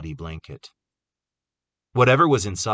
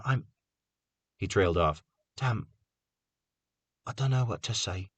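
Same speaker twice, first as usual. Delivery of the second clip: sympathetic; 5 semitones higher than usual. A narrator's reading. Prentice consoling Carter softly.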